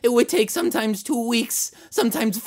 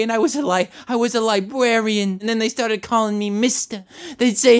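ordinary talk

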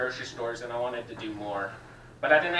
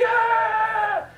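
sudden high yelled "Ah!"; a loud, high startled scream; retold scream; 10 dB louder than usual. Retelling the garbage truck hitting the chaser, loud high scream. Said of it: "the guy starts screaming".

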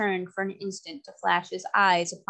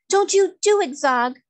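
much higher, louder indignant outcry; indignant cry; 11 semitones higher, 8 dB louder than usual. A narrator's reading. Trot stopping Cap'n Bill's sacrifice, crying out indignantly. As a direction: cry out in protest at him. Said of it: "cried Trot indignantly".

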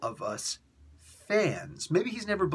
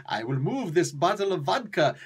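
bad Russian accent; 4 semitones higher than usual. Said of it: "my accents suck".

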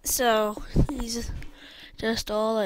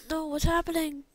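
high-pitched alarm; acted panic; 7 semitones higher than usual. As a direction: play a character in mild panic. Character panics as vines attack.

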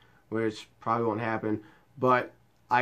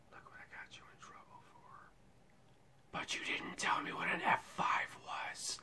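soft, breathless whispered taunt; hushed mocking whisper; 17 dB quieter than usual. Real, not acted. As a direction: whisper it like a secret gloat. Teasing aside to absent person.

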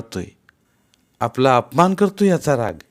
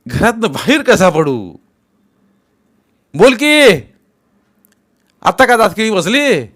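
much higher and louder tirade; furious shouting; 11 semitones higher, 8 dB louder than usual. Acted. Patil shouting at his mother over lost votes, furious.

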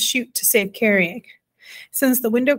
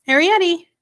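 high, loud summons; calling out; 8 semitones higher, 5 dB louder than usual. A narrator's reading. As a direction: call a child from another room. Mother calling her daughter in.

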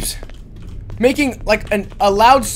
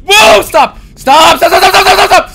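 panicked yelling; 8 semitones higher, 10 dB louder than usual. Real, not acted. Monster chasing, panicked yelling.

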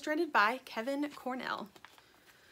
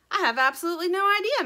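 fast, higher and louder; quick cheerful admission; 9 semitones higher, 8 dB louder, faster than usual. Acted. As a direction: rattle it off brightly. Dirt fast and loud.

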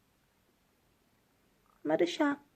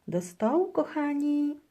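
grandma calling; 9 semitones higher than usual. A narrator's reading. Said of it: "woła babcia".

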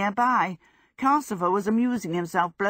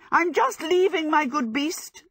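high-pitched flustered call; high, panicky excuse; frantic cry; 10 semitones higher, 3 dB louder than usual. A narrator's reading. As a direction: cry out hastily to placate a threat. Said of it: "Sophie cried".

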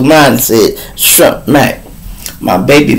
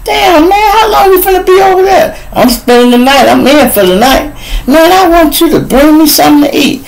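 put-on demanding girlfriend. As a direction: nag him fast in a high pitched woman's voice. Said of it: "Bitch ask me".

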